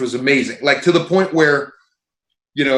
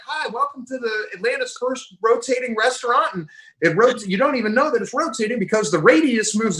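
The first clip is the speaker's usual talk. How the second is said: higher, sing-song server greeting; chirpy waiter; 7 semitones higher than usual. Retold or imitated. The waiter greets the table at the rotating restaurant, mock chirpy. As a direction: play the eager waiter reciting his spiel. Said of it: "the waiter comes over and it's like".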